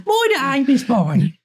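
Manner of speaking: high, exaggerated Brummie; mock Brummie accent. Mocking the Brummie attempt.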